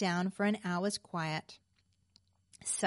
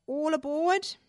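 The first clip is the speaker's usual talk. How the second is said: raised, high conductor's call; 7 semitones higher, 4 dB louder than usual. A narrator's reading. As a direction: call all passengers aboard. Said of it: "Just then the conductor cried".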